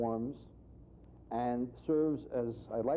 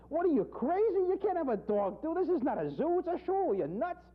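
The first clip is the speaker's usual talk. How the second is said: high, loud outraged scolding; indignant objection; 4 dB louder than usual. Acted. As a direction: scold him for a crazy idea. Ritual director objects.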